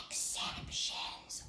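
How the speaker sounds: hushed, unvoiced whisper; playful whisper. Shown as a demonstration. Teacher naming the spelling rule to pupils, whispered. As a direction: whisper it like a secret keyword.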